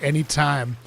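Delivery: ordinary talk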